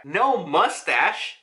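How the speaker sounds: ordinary talk, nothing marked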